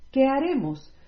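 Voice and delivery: alarmed question. Acted. Priests in council fear losing their nation, alarmed outburst. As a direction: ask it in alarm, voice jumping up.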